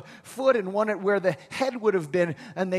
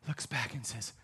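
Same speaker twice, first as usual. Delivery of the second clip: ordinary talk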